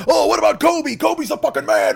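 high, pushy know-it-all fan. Retold or imitated. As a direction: push Kobe like a barstool expert. Imagined fan voice, far, high.

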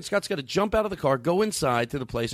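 ordinary talk

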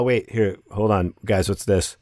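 ordinary delivery

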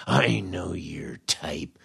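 slow unvoiced whisper of a sneer; slow sinister whisper imitating the boss; mock whisper. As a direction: hiss it slowly like a menacing secret. Manager's parting jab acted out.

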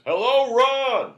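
huge, high, shouted hello; booming greeting. Retold or imitated. Comic greeting shouted across the set. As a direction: yell the greeting like a running joke. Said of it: "Saget's joke with me was always".

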